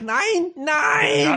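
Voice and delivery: high-pitched German cries of protest; mock Hitler outcry. Comic imagines Hitler being violated, high mock cry. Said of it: "He's like".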